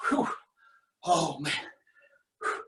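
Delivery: huffing after burpees; winded relief; out of breath. Real, not acted. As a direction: blow out the breath, spent. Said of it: "I'm gonna be out of breath".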